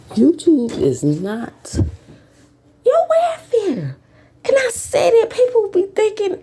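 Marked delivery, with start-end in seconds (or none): 2.9-4.0 much higher, slow, stretched out; slow high-pitched stress on welfare; emphatic drawn-out point. Real, not acted.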